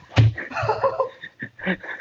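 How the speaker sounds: high breathless laugh; nervous laugh after a fright; shrill startled laugh. Real, not acted. Laughing after spider scare.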